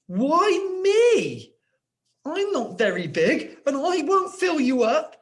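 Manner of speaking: high, frightened little pig voice; scared, quivering. Shown as a demonstration. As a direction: plead like a scared piglet. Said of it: "So Percy quivered".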